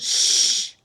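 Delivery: sharp urgent shush; loud warning shush; hushing. Acted. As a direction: hush your friend so nobody hears. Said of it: "Freak goes".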